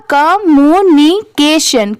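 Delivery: Communication drawn out into separate syllables; deliberately slow teaching read. Shown as a demonstration. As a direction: spell out communication slowly, piece by piece. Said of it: "ऐसे इसे slow में नहीं पढ़ेंगे".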